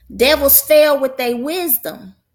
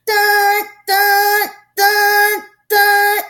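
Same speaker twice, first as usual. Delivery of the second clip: high, loud, even beeps like a reversing machine; backing-up beep; 11 semitones higher, 5 dB louder, much slower than usual. Retold or imitated. Preacher imitates a machine backing up, high loud beeps. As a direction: beep each dun sharply, evenly spaced. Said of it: "it makes this".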